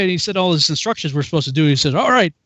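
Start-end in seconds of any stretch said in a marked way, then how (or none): 1.9-2.3 higher, forceful mock command; sergeant order. Sergeant orders recruit into office, mock commanding. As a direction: send him in like a drill sergeant.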